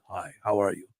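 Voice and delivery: low, soft, casual greeting. Retold or imitated. Imitating [name] greeting strippers.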